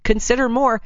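usual speaking voice

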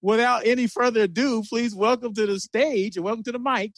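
high, excited host announcement; announcer. Acted. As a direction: bring the guest on like a host. Host introduces the guest.

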